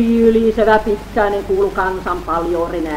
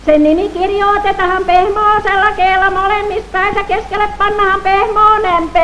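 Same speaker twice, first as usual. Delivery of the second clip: high, far-off bawling pedant; high hollering pedant voice; bawling know-it-all; 10 semitones higher than usual. Acted. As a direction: bawl it like a self-important bookworm. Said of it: "Hoilaa jokin väärän kirja oppinut".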